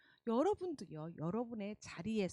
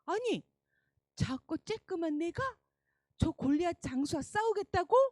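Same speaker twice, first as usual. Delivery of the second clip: surprised; 7 semitones higher, 5 dB louder than usual. Acted. King Saul doubts David.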